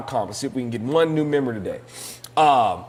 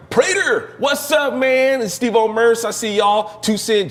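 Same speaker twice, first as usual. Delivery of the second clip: calling out; 9 semitones higher, 3 dB louder, slower than usual. Real, not acted. Shout-out to a viewer.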